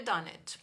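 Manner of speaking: done it linked into one word, no E between. Shown as a demonstration. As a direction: join done it smoothly. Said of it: "a gente não fala o E". (done it emendado, sem o E)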